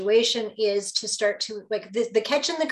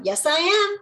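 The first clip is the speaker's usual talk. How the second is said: higher and louder, snapping back; raised parent voice; 9 semitones higher, 6 dB louder than usual. Retold or imitated. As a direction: shoot back at the kid, voice jumping up. Mother snapping at defiant daughter.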